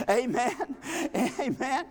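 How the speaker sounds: ordinary talk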